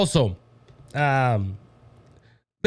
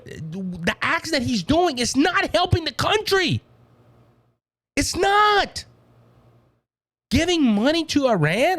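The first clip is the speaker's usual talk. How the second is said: high, heated rant ending in a shout; rising anger; 10 semitones higher, slower than usual. Real, not acted. Host rants at listeners about the president, shouting. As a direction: let it boil over.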